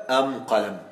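saying أم قلم with the article sounded as am; am-qalam, the old Himyar way; Himyarite am for al. Shown as a demonstration. Teacher demonstrates Himyar dialect to students, pronouncing am. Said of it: "يقولون في القلم".

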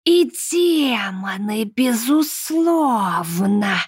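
slow, breathy whisper. Acted. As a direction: drawl it out in a whisper. Red-eyed speaks, unvoiced.

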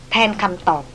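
usual speaking voice

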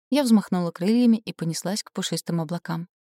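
ordinary delivery, nothing marked

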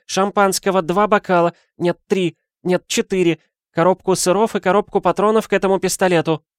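high, loud lady's voice; ordering madame. A narrator's reading. Madame ordering champagne and cartridges from porter, imperious. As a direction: order briskly, revising as you go.

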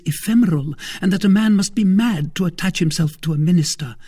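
high, emphatic woman's voice; Madame Bonacieux, spirited wife. Acted. Wife warning her husband about ministers, insistent. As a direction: argue it firmly, with urgency.

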